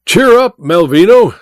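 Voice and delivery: high, loud cheering call; calling through door. A narrator's reading. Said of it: "said Welton through the door".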